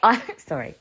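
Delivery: ordinary talk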